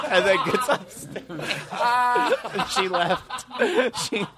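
words squeezed out through high laughter; laughing while talking. Real, not acted. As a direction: tell it while cracking up.

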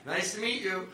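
high, polite backing-away voice; uneasy pleasantry. Retold or imitated. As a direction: say it high while edging away. Mimicking people backing away nervously.

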